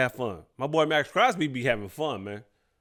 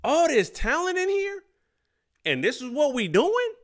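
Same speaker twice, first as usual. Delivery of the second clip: high-pitched, drawn-out disbelief; high mock-incredulous questioning; incredulous player; 11 semitones higher, slower than usual. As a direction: imitate a teammate stunned by what he's seeing. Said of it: "looking around the locker room like".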